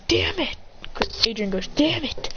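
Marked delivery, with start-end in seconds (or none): 0.1-0.5 high, held-back "damn it" of a cornered fighter; mock frustrated cry. Cornered characters curse in the story. 1.7-2.3 high, held-back "damn it" of a cornered fighter; mock frustrated cry. Adrian curses, forced to fight.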